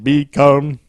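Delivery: put-on African-voice alien; African accent. Co-host correcting host's alien voice, African accent. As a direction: show how an African accent goes. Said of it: "Do an African voice".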